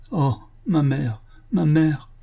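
slow, high lament of a crushed woman; distressed murmur. A narrator's reading. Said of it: "puis elle murmura". (plainte murmurée, lente et aiguë)

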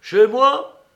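high, loud outburst in the master's rough voice; muffled, hoarse master. A narrator's reading. Master laying down his rule to Martial, hoarse and forceful. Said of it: "la même voix à la fois assourdie et rauque".

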